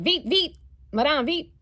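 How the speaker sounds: high, loud urging in French; telling the Paris cab driver to hurry, loud French; urgent French. Retold or imitated. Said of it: "and I was like".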